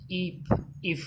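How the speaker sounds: a clipped short "i" in "if"; teacher modelling the short "i" in "if"; short i demo. Shown as a demonstration. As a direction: say "if" crisply twice, vowel kept short. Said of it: "जैसे".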